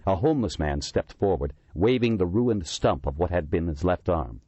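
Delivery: ordinary delivery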